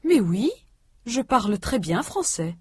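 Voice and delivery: ordinary talk, nothing marked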